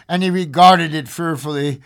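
ordinary delivery

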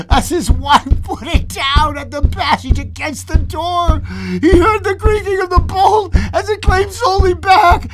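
gasping, breathless race to the climax; frantic storytelling. A narrator's reading. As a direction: build to high, shouted narration.

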